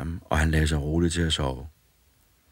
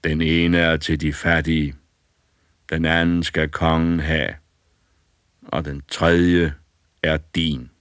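slow, louder solemn pronouncement; solemn old spirit; 5 dB louder than usual. A narrator's reading. Old spirit solemnly divides the gold for the boy, louder. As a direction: ceremonial gift of treasure.

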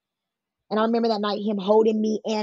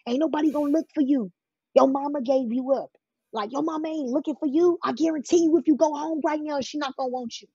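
higher-pitched retold taunts, pressed home line by line; manipulative taunting; 6 semitones higher than usual. Pimp telling the girl nobody wants her, manipulative taunting. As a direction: imitate him insistently wearing her down. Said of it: "And he was just like".

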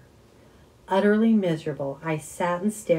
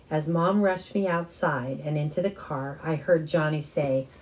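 ordinary delivery

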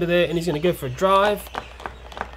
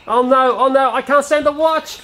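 mock horror; 7 semitones higher than usual. Watching the son wreck the set.

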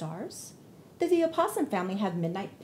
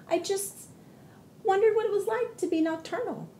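much higher sheepish voice; small bunny voice; 10 semitones higher than usual. Acted. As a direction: explain meekly. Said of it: "Thumper said".

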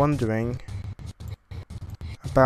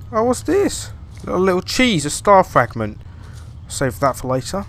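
usual speaking voice

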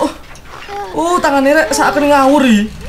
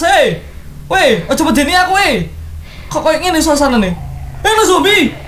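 ordinary talk, nothing marked

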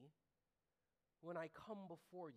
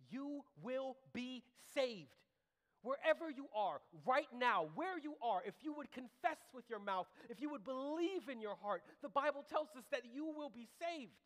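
loud, high, urgent altar appeal; full-force preaching; 8 semitones higher, 8 dB louder than usual. Real, not acted. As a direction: proclaim it forcefully to everyone listening.

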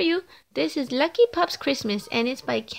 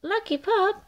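much higher glad call; relieved calling; 8 semitones higher than usual. Acted. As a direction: call out happily to the found pup. Family finds the lost pup.

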